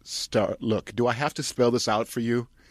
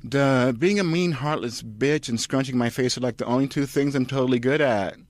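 bratty Kirsten; 5 semitones higher, 3 dB louder than usual. Acted. Kirsten bragging to Charlotte, high bratty voice. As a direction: sneer it proudly.

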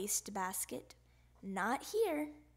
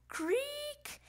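door creak; 14 semitones higher than usual. Acted. Door creak sound effect.

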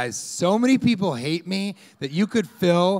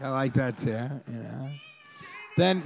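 ordinary delivery

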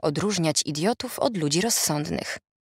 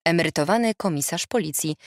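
ordinary delivery

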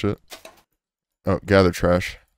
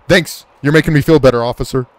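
loud, higher-pitched sarcastic retort; sarcastic indignation; 6 semitones higher, 5 dB louder than usual. Pretend. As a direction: throw mock thanks back at the officer. Sarcastic retort to policeman's warning.

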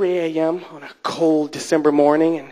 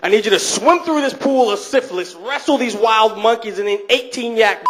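higher, projected host announcing a stunt; game show host; 6 semitones higher than usual. Retold or imitated. Fear Factor host briefing a contestant, hammed-up announcer. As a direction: announce the challenge in a put-on host voice.

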